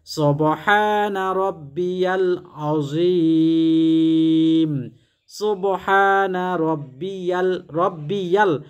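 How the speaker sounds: each Arabic syllable drawn out very slowly, a measured chant; slow careful recitation. Shown as a demonstration. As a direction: recite the prayer slowly, syllable by syllable, as a model to copy. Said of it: "এই তাসবিহটি তিনবার পাঠ করবেন".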